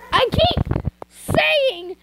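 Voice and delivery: high, loud, dragged-out groan at the mistake; exasperated at flubbing the line; frustrated outburst. Real, not acted. Reader fed up with her own slip, loud and high. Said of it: "I said mugs".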